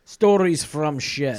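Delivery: put-on slow, loud Russian accent. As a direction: announce it slowly in a Russian accent. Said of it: "you wanna do it in your Russian accent".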